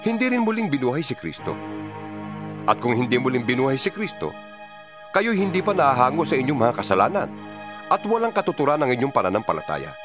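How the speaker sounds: plain reading voice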